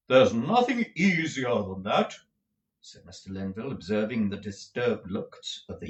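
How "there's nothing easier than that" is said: pompous tragedian. A narrator's reading. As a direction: roll it out like a stage tragedian. Lenville's tragedian voice.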